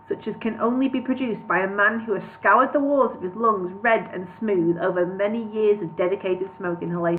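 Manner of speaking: ordinary delivery, nothing marked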